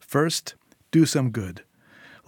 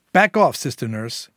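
loud warning to back off; sharp warning; 7 dB louder than usual. Acted. Syl holding the baby warns off the nurse, sharply. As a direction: warn her off forcefully.

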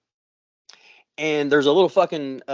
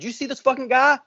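retold urgent question; 10 semitones higher than usual. Retelling asking the onlookers excitedly.

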